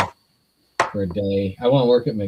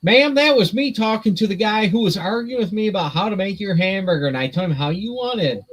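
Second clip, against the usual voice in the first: raised, rushed excuse voice; high mock explaining; 8 semitones higher than usual. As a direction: explain yourself in a high flustered voice. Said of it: "retells excited explanation".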